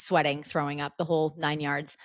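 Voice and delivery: usual speaking voice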